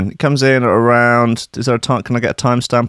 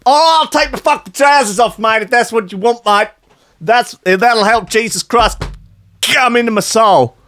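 mock Australian; 12 semitones higher than usual. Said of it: "Paulie's, um, Australian".

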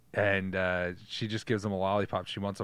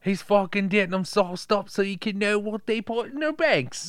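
mock British accent; 9 semitones higher, 4 dB louder than usual. Joking British voices about the film.

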